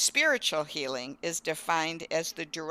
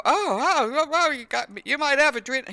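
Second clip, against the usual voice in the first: high, lightly hammed-up panicky doctor; mock fretting physician; mock alarmed doctor; 9 semitones higher, 3 dB louder than usual. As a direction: parody a doctor who gets flustered. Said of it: "he doesn't sit there and go".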